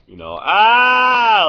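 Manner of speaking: a long, high, loud, slow wail; drawn-out mock noise of loud music; mock loud music blare. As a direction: blare it like deafening speakers. Mimicking deafening fair music.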